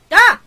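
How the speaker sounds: loud high shriek; startled scream. Acted. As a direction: let out a sudden scream. Scream after mermaid reveal.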